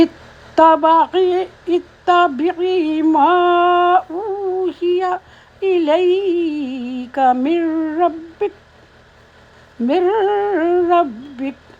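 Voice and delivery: slow, high, melodic chant; Quranic recitation. Acted. Teacher reciting the next verse, slow melodic chant. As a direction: recite tilawat-style, every syllable stretched.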